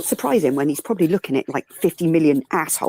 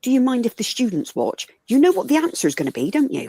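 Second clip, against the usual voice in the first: sassy retort; 8 semitones higher than usual. Retold or imitated. Retorting to surgeon.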